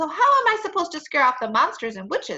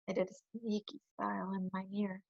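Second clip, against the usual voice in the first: soft, low and conspiratorial; hushed, sly storytelling; sneaky; 4 semitones lower, 12 dB quieter than usual. A narrator's reading. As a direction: confide a secret scheme. Narrator describes sneaky plotting.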